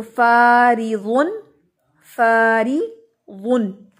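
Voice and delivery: फारिदुन chanted slowly, thin unrolled री; tajweed recitation. Shown as a demonstration. Teacher models the word for students, slow recitation. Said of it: "ये नहीं पढ़ेंगे फारी, री".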